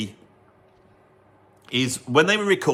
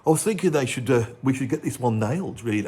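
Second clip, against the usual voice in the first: [name] impression. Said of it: "I'm being [name]".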